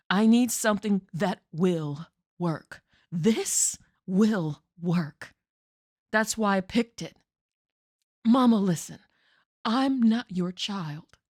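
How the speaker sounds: rising to a loud, determined cry; forceful outburst. Acted. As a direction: push back hard at Mama. Daughter's outburst at Mama.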